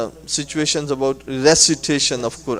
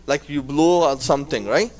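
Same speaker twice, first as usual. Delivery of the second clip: normal talking voice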